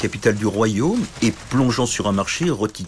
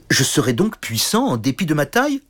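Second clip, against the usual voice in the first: étonné; 7 semitones higher than usual. Acted. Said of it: "fut étonné".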